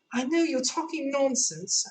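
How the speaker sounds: Alice muttering inwardly; young girl voiced high; Alice to herself. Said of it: "Alice thought to herself".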